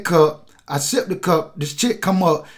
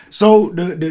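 ordinary talk

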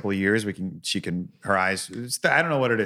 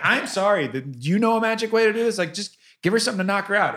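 high, fast, desperate pleading; frantic parent; 8 semitones higher, faster than usual. Retold or imitated. Parent at the hospital begging nurses, high and frantic. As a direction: beg the nurse like you're at the end of your rope.

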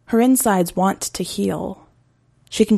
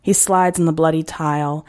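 plain reading voice

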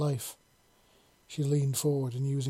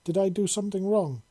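higher and louder, worried; alarmed exclamation; 6 semitones higher, 5 dB louder than usual. Acted. Startled partner asks if he erred, loud and high. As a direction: blurt the question out in alarm.

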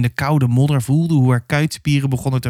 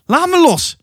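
very high, moderately loud narrated shriek; 3 dB louder than usual. A narrator's reading. Captured girl struggles with her attacker, shrieking. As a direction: cry out to break free. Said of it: "gilde Hasse".

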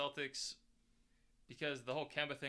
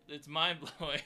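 put-on Boston accent; 2 semitones higher, 8 dB louder, slower than usual. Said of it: "Mister Kennedy".

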